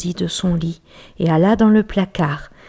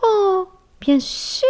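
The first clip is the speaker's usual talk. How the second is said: very high, slow mouse voice; a tiny squeaking promise; squeaky mouse; 13 semitones higher, much slower than usual. Acted. Mouse promising the bear silence, very high and slow. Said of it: "fit la souris".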